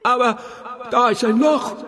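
put-on high, panicky girl's voice; panicked cry. A narrator's reading. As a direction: Doro cries out in fear at the hole. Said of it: "rief Doro voller Panik". (panisch, mit hoher Stimme gerufen)

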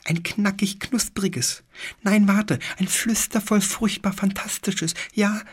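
very high, whispered little creature voice with a lisp; a tiny furry beast begging in a high lisp. A narrator's reading. Fur pig demands a book, high lisping whisper. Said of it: "lispelte das Pelzschwein".

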